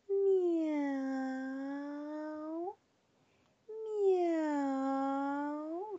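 miau agudo y dulce; maúlla suave como un gato; maullido dulce. A narrator's reading. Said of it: "con un maullido muy dulce". (el gato a la ratita, maullando dulce)

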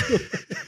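breathy suppressed giggle; stifled giggles. Real, not acted. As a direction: laugh escaping under the breath. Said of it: "A gente não para de rir". (risadinha abafada)

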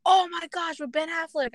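high, excited recognition; light up in excited recognition. Retold or imitated. Brother recognizing the movie on the phone, high and excited.

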